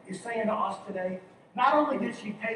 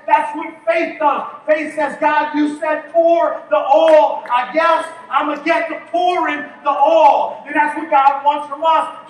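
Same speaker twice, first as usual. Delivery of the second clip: louder, higher sermon build; preaching at full force; 6 semitones higher, 11 dB louder than usual. Acted. Pastor preaching to the congregation, full force. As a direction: preach it out hard to the church.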